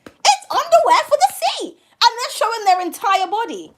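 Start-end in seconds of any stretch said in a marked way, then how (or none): 0.1-1.7 much higher, incredulous squeal of insistence; exasperated high emphasis. Real, not acted. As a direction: drive the point home at the top of your voice. Repeats her point, exasperated.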